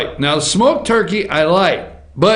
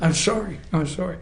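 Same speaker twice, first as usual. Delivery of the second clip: soft mock apology; 8 dB quieter than usual.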